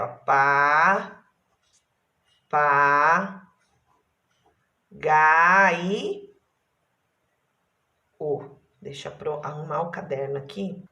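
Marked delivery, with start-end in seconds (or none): 0.3-8.6 Pa, pa, gai, o with long pauses; syllables separated. Shown as a demonstration. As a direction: dictate Pa, pa, gai, o one syllable at a time. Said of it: "Olha lá, separei".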